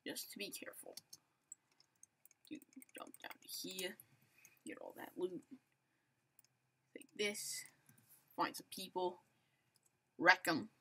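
hushed whisper while sneaking up on players; sneaky whisper. Real, not acted. Gamer whispering while creeping toward an enemy, hushed. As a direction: breathe the words so nobody nearby hears.

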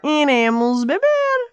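high cartoon dog voice; eager little dog voice. Another dog answers.

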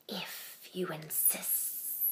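slow, soft whisper; snake whisper. Acted. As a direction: draw it out in a hushed whisper like a snake. Snake answers the ladybug.

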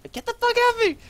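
high, loud frantic scream; panicked yell. Real, not acted. Player under attack, screaming in panic. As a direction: shriek at the enemy to back off. Enemy attacking his hero.

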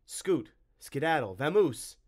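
loud, high, drawn-out dismissal; shooing away. Acted. Tim chasing the monster off, loudly shooing. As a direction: build it up to a shout.

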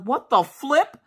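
high-pitched shocked outburst; startled disbelief. Retold or imitated. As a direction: react as if the dog just talked. Shocked that the dog spoke.

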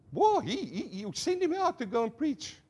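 high-pitched, stammering comic excitement; hammed-up astonished exclamation, pitch leaping up; mock amazement. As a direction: gush in pretend awe at the bird. Acting out excitement over the parrot.

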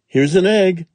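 Jack cries it out high and loud; excited shout. A narrator's reading. Jack shouts to his mother, high and loud. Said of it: "shouted Jack".

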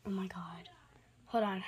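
soft whispered oh my god; hushed whisper of disbelief; awed whisper. Real, not acted. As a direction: breathe it in awe.

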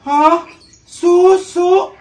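loud, high, startled echo; shocked disbelief. Acted. Neighbour hearing the odd brand name, shocked. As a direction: blurt it back in disbelief.

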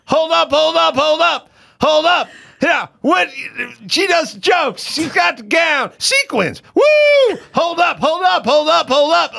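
high shouted hype man chant; [name] impression. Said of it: "fifteen-second rap, something that rhymed".

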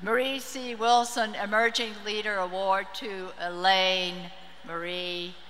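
usual speaking voice

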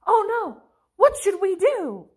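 high-pitched mock dismay; mock alarm. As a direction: play up a helpless "oh no". Mock panic at stupidity epidemic.

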